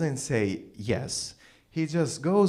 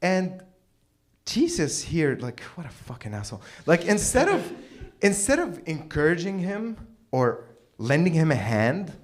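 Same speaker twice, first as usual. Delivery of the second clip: usual speaking voice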